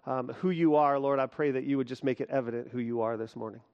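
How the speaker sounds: hushed, soft prayer voice. Acted. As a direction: pray it low and earnest. Closing prayer before sermon.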